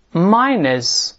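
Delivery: "minus" read aloud slowly, MY-nus, tongue flat, no curled R; model reading. Shown as a demonstration. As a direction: say "minus" clearly for the class to repeat. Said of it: "我们大声读一下". (示范朗读"minus"，舌头放平不卷舌)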